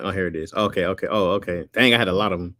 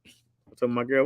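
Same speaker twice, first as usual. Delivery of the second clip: usual speaking voice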